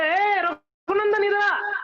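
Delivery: drawn-out, high-pitched call to a son; acted summoning call, pitched well up; father calling out. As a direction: call the boy over, stretching the name. Father arrives and calls son.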